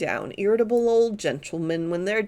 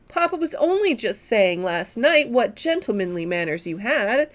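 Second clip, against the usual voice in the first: ordinary delivery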